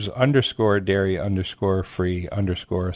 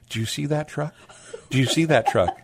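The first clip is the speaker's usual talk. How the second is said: excited; 6 semitones higher than usual. Retold or imitated. Excited customer retold.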